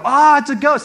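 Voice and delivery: high, loud panicked cry; acted scream. Disciples freaking out on the boat, acted scream. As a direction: freak out in mock terror. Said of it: "like, screaming".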